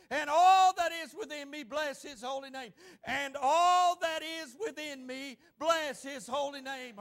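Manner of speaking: pace it so the room can follow; leading congregational recitation. Shown as a demonstration. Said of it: "Everybody say with me this morning".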